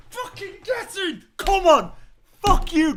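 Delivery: loud, high roaring celebration; ecstatic shout. Real, not acted. Fan screaming at the referee after a goal, ecstatic roar. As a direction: bellow it like the ball just hit the net.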